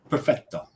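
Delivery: perfetto with a rolled Italian lilt, stress on fet. Shown as a demonstration. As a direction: savor perfetto like a native Italian. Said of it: "I just love the way that sounds".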